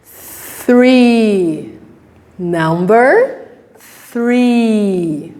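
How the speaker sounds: three said slowly with th; careful three. Shown as a demonstration. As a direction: stretch out number three. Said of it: "Can you say again?".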